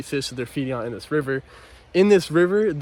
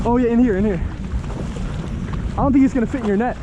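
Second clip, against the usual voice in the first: excited; 7 semitones higher than usual. Real, not acted. Landing a huge fish.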